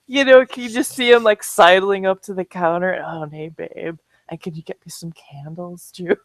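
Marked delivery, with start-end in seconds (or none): 3.0-6.1 low, soft imagined sweet-talk; smooth charmer. Pretend. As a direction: sidle up with a hushed, mock-flirty request. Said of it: "he's such a charmer".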